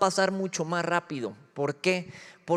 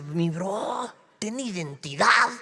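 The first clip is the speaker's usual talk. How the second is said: mocking older sibling; 3 semitones higher, slower than usual. Mocking the brother who comes back with an accent. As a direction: tease him about losing himself. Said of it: "siempre me burlaba de él".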